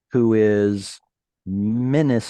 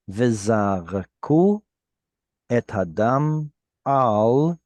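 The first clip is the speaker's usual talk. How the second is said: careful slow reading of the Hebrew verse; slow Hebrew scripture reading. Acted. Teacher reads the verse to the class, slow and measured. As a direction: read each phrase deliberately, pausing between.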